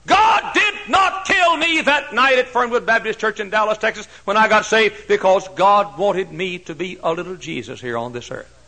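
high, loud pulpit cry; fiery Baptist preacher in full flight; full-force preaching. Acted. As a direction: proclaim it to the whole room.

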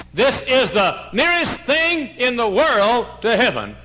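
loud, high, slow proclamation; preaching shout. Acted. Preacher proclaiming a visitor's words, loud and high. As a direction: belt it like a sermon climax.

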